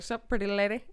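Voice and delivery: lightly voiced cartoon ladies' man; a hint of Southern swagger; Johnny Bravo. Imitating Sebastian flirting like a cartoon, light Southern swagger. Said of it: "He's like a weird Southern Johnny Bravo".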